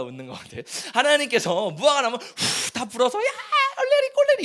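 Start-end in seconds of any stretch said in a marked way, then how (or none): 3.2-4.4 much higher, louder sing-song 얼레리 꼴레리; childish taunt. Pretend. Preacher imagining God teasing Adam and Eve, sing-song jeer. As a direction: tease like a child in the schoolyard. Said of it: "이렇게 하지 않으셨잖아요".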